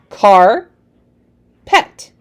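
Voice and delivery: "car, pet" as two separate chunks, long pause between; read in chunks. Shown as a demonstration. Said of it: "read the word in chunks".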